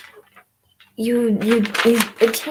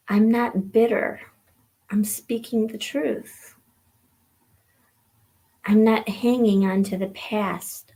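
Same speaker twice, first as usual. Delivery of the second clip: poem reading. Acted. Reads a poem aloud.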